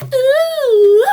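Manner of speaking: high wailing ghostly ooh; spooky ooh. Acted. Storyteller wails for the spooky body part, high ghostly ooh. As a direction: wail it like a ghost. Ghostly creature noise in tale.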